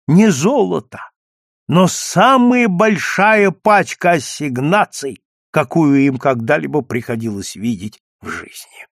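ordinary delivery

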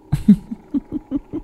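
high, soft, drawn-out cackle; ghostly cackle. A narrator's reading. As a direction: cackle eerily like a vengeful ghost. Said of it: "mà cười khành khạch". (cười khành khạch ma quái)

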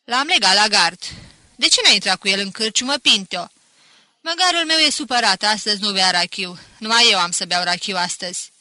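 loud, lively calling out; boisterous tavern banter. A narrator's reading. Villagers and Pinto banter about his donkey, boisterous. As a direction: call across a noisy tavern.

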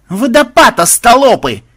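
high, loud alarmed yell; urgent shouted alarm; screaming warning. A narrator's reading. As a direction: scream a danger warning at slow companions. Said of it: "завопил он".

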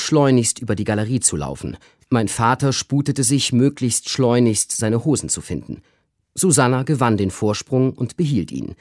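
usual speaking voice

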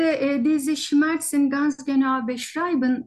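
ordinary delivery

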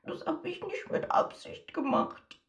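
slow, high, breathless sob; sobbing. Acted. Marietta sobbing from next door, defending herself. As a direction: sob it out as a hurt little girl. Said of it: "schluchzt Marietta".

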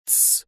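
soft, voiceless "tss"; quick hushing hiss; hissed shush. A narrator's reading. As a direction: hiss for silence under the breath. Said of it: "зашипел я".

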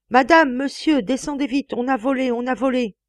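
shouting up the stairs in alarm, high and loud; cri d'alarme. Acted. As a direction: call the household down in panic. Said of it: "il cria dans l'escalier". (crier l'alarme dans l'escalier)

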